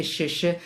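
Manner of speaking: "sh, sh" as a hissed rioplatense Y; rioplatense sh sound. Shown as a demonstration. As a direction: sound out "sh, sh" like a porteño Y. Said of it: "un refuerzo de la Y con una S H". ("sh, sh" como la ye rioplatense)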